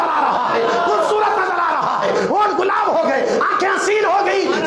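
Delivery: fervent pulpit cry; preaching at full force. Acted. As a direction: call it out to the whole congregation. Long impassioned preaching run.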